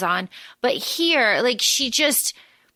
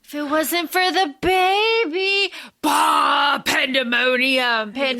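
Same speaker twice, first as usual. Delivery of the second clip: mock outburst; 4 semitones higher than usual. Acting out crowd outrage.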